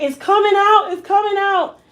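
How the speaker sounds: mother wailing in the shower as her hair falls out; crying mother. Retold or imitated. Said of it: "And she's crying".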